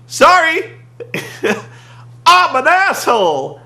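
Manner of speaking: high, loud hammed-up apology; mock carefree wrestler voice; mock cheery apology. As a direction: toss off a goofy fake sorry. Imitating Anderson's fake apology.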